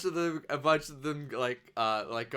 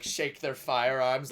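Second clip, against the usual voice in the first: usual speaking voice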